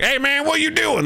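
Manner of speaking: high Southern guard greeting. Acted. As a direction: holler it up high with a Southern twang. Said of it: "they're all Southern folks".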